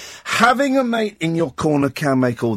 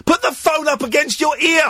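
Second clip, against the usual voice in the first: shouted instruction; 10 semitones higher than usual. Real, not acted. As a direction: bark the order playfully. Host yelling instruction at caller.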